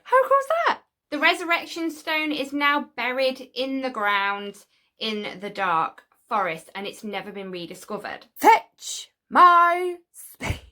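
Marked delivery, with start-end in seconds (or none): 0.0-0.8 high, loud delight; excited. Real, not acted. Delighted by own frog card. 8.3-10.6 slow, booming, high call; mock command. Mock order to dig up stone.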